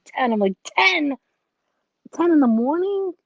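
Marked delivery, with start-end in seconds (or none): 0.6-1.2 high, loud echo of disbelief; incredulous. Retold or imitated. Retelling her reaction to early hour. 2.1-3.1 high, loud questioning squawk; incredulous. Retold or imitated. Retelling her reaction to early hour.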